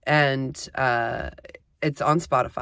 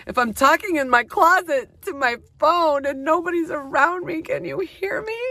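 much higher, hammed-up crying plea; mock wail; 13 semitones higher than usual. Talking alone into her phone, a mock tearful wail. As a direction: wail it like a lost soul, played for laughs.

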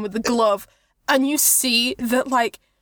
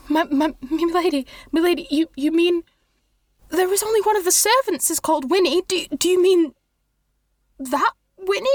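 higher, stammering, hurried groveling voice; nervous monk; 5 semitones higher, faster than usual. Acted. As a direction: grovel and rush the apologies. Nervous monk questions lady, apologises.

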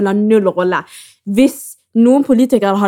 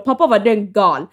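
ordinary delivery

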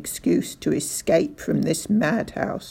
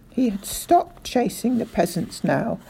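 usual speaking voice

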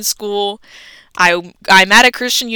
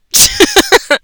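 squealed laugh at the story; high laugh; 5 dB louder than usual. Real, not acted. Laughing at professor's fight offer.

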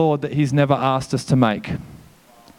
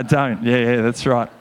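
ordinary talk

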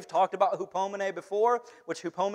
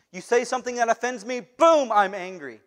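loud, quick-tempered outburst; a hammed-up angry rant; mock hothead; 5 semitones higher, 6 dB louder than usual. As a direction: play the short fuse with a burst of volume. Second acted flare-up.

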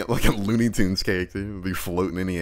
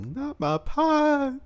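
high drawn-out mock cry; slow, high-pitched wail without full volume; Luis's mock yell; much slower than usual. Wanting to hear Luis yell, imitated high mock yell. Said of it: "I wanna hear Luis yell".

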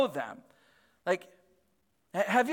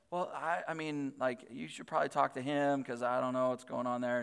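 soft, low, hesitant imitated reply; wife's timid answer, voiced softly; meek deflection; 4 semitones lower, 8 dB quieter than usual. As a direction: stammer a polite brush-off quietly. Wife deflects the angry neighbor.

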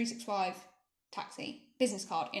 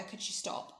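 hushed plea to the driver; timid whispered request; whispered ask; 10 dB quieter than usual. Retold or imitated. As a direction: ask it under your breath.

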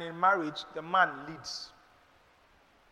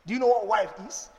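ordinary talk, nothing marked

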